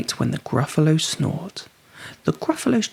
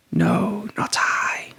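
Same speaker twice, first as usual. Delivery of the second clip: snake voice; 6 semitones higher, slower than usual. A narrator's reading. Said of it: "said the snake".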